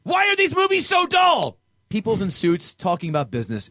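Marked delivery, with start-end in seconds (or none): 0.0-1.6 high, loud whining complaint; mock exasperated. Host quoting a bored reviewer, loud exasperated whine. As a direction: wail it in mock despair. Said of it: "Is it much?".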